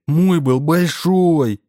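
put-on high, slow, drawn-out child's whine; whining child. A narrator's reading. Boy insisting to the wizard, high slow child's whine. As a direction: stretch it out like a sulking kid. Boy demands bigger ball.

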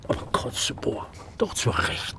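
conspiratorial whisper. Acted. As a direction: drop to a secretive whisper. Confides political leaning.

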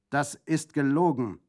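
loud, gelogen hit with a falling end; angry shout. Acted. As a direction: bark the denial. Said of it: "riefen beide Brüder zugleich". (lautes, empörtes „gelogen“)

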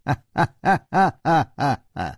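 a big, open-throated laugh that falls away; delighted laugh at old friends arriving; hearty laugh. A narrator's reading. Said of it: "口中大笑道".

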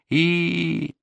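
high, soft drawn-out whine; thin keening on one held high note; thin wail. A narrator's reading. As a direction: wail thinly like a grieving grandmother. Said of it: "тоненько завыла она".

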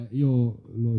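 ordinary talk

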